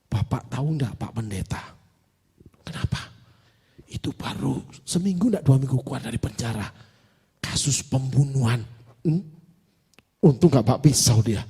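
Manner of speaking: low, soft retold warning; hushed confiding. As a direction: confide it quietly, imitating a worried informant. Congregant confides a warning to the pastor.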